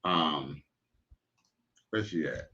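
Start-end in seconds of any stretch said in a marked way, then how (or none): none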